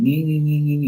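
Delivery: put-on high childish taunt repeated; lightly voiced taunt; mocking nee nee. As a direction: keep mimicking the teen's nee-nee. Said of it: "And he kept going".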